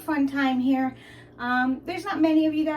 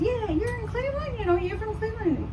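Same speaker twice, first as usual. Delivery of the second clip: store guy; 10 semitones higher than usual. Retold or imitated. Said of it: "the guy calls him back and says".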